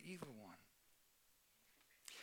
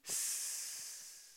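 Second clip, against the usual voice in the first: hissing fizzle. Shown as a demonstration. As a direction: hiss out the sound of the fire going out. Arrow fire hissing out.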